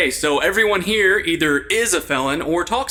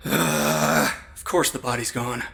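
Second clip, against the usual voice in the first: slow, soft, defeated; exasperated sigh of a line; weary resignation; 6 semitones lower, 4 dB quieter, slower than usual. Acted. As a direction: say it like nothing surprises you anymore.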